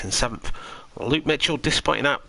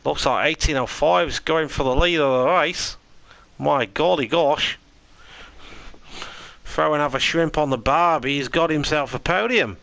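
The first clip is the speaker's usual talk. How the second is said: mock Aussie commentary; 5 semitones higher than usual. Commentating the race for chat in a mock Aussie accent. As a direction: play a jokey Aussie race caller. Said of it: "in a full Aussie accent".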